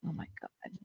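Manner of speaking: whispered "oh my God". Real, not acted. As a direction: breathe it in a whisper. Whispered aside while buying.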